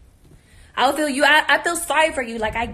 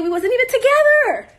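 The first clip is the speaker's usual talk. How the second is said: emphatic; 10 semitones higher, 4 dB louder than usual. Real, not acted. Emphatic retort.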